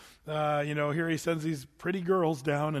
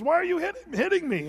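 high-pitched donkey voice; talking donkey; 11 semitones higher, 3 dB louder than usual. Acted. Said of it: "The donkey says".

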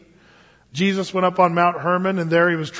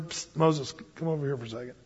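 much softer, low beckoning; the Lord quietly calling Moses over; quiet call aside; 4 semitones lower, 11 dB quieter, faster than usual. Retold or imitated. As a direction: pull him aside in a hushed voice. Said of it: "the Lord said".

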